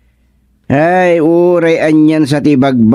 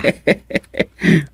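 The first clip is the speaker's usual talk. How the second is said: soft laugh; 6 semitones higher, 4 dB quieter than usual. Acted. Joking that coffins sell well.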